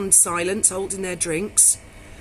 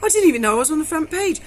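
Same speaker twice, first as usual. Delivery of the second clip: high, loud, fast horrified protest; 7 semitones higher, 5 dB louder, faster than usual. Retold or imitated. Barmaid to reporter, horrified, high and fast. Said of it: "And I said".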